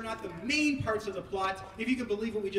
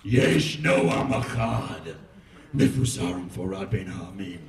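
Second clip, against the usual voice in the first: very low, sinister villain voice; evil voice; 13 semitones lower, 5 dB louder than usual. Acted. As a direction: sneer it like an evil schemer. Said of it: "the voice of Haman is going to be an evil voice".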